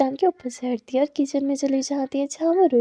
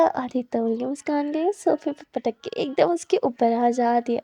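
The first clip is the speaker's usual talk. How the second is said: plain reading voice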